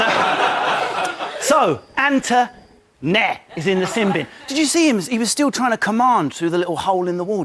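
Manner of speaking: normal talking voice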